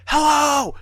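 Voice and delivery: very high, loud screamed hello; retold scream. Boy screams at figure creeping up the stairs, re-enacted scream. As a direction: scream it out at a frightening intruder, retold. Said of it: "I'm screaming at this point".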